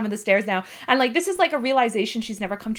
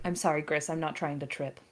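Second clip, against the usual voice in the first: soft, lower apologetic voice; timid apology; 7 semitones lower, 12 dB quieter than usual. Acted. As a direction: mumble a sorry while wobbling. Leon apologizing, wobbling.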